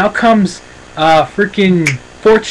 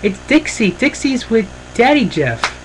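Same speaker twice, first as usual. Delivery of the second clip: put-on higher, chirpy kid voice pointing something out; lightly voiced little daughter; mimicking a child; 9 semitones higher than usual. Kurt's daughter spots Dixie with Jeff, in a lightly high kid voice. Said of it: "points out and says".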